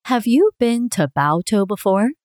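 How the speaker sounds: ordinary talk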